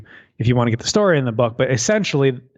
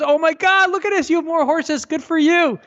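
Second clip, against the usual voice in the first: very high, excited neighbor voice; hammed-up delighted neighbor; gushing neighbor; 13 semitones higher than usual. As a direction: squeal it like the thrilled neighbor.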